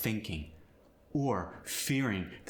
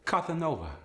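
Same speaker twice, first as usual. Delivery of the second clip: Casanova with a Castilian th, higher; Castilian Spanish accent; 6 semitones higher, faster than usual. Shown as a demonstration. Teacher demonstrating Castilian th, Castilian accent. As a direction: lisp the S in Casanova, Castilian style. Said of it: "the S gets the T H sound".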